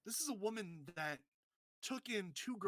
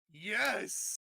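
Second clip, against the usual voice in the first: louder, triumphant yes; excited cheer; 3 semitones higher, 8 dB louder than usual. Retold or imitated. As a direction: cheer like your favourite just won. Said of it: "And I'm just like".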